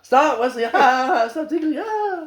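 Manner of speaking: high, loud laughing protest; hammed-up ticklish shrieks; mock tickled squealing.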